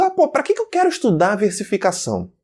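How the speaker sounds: high-pitched imitation of a reluctant student; mock student complaint. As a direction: complain like a pupil questioning the point. Voices a sceptical student's objection.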